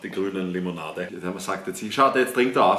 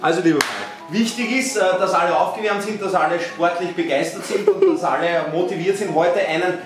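higher, upbeat presenter voice; mock tutorial host; 7 semitones higher than usual. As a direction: play a cheesy fitness host. Hosts a mock live tutorial.

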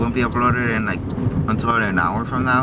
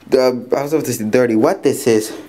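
usual speaking voice

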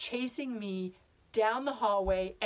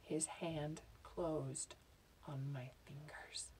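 very slow, low, sinking to a whisper; hushed dread, drawn out; suspenseful hush; 7 semitones lower, 16 dB quieter, much slower than usual. Acted. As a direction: let each word creep out softly. Suspense as the hand closes.

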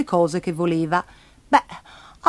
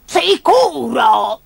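drawn-out storybook squawk, pitched up; higher, stretched-out cranky shriek; shrieking grandma; 4 semitones higher, 4 dB louder, much slower than usual. Acted. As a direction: screech it slowly as a cross granny. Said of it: "strillò lei".

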